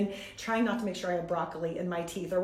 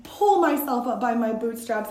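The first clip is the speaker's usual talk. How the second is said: usual speaking voice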